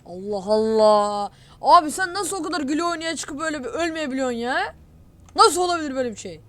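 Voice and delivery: real rage at the game, stretched and loud; exasperated yell. Real, not acted. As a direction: bellow in furious disbelief. Killed again, disbelief.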